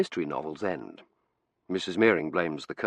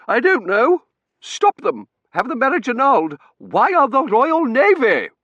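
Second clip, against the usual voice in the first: hysterical shrieking; 11 dB louder than usual. Acted. Hysterical wife berates husband.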